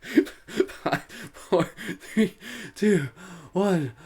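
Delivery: counting down through high bursts of laughter; laughing countdown. Real, not acted. Host counts down the laughter exercise, laughing. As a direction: gasp out the numbers while still laughing.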